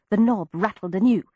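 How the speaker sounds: plain reading voice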